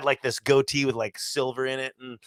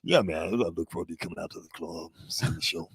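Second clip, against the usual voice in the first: low, soft put-on promo voice; artist voice; 8 semitones lower, 7 dB quieter than usual. Comic promotes his club show, artist voice. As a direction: drop low, like a performer on radio. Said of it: "this is my artist voice".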